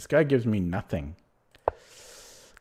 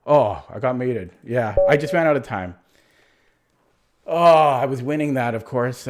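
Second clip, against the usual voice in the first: frustrated outburst; 3 semitones higher, 7 dB louder than usual. Real, not acted. Loses on time, exclaims.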